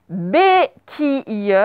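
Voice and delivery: BÉQUILLE very slow, each syllable set apart; syllable-by-syllable dictation. Shown as a demonstration. Teacher dictating the third word to pupils, syllable by syllable.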